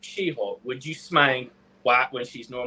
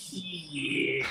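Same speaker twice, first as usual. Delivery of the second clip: ordinary talk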